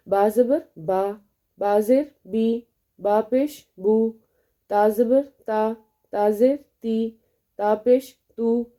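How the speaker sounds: slow, careful reading of با زبر با and تا پیش تو, each vowel drawn out clearly; teacher sounding out each syllable slowly; spell-out drill, slow and clear. Shown as a demonstration. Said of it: "اگر میں کہتی ہوں با زبر".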